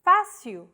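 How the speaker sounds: "Fácil" with stress on the open FA, FAH-seew; stressed accented vowel. Shown as a demonstration. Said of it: "used to indicate a stressed vowel".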